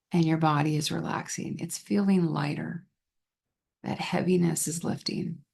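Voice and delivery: calm guided relaxation with long pauses between phrases; guided visualization. Shown as a demonstration. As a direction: lead them gently into calm, leaving space to breathe.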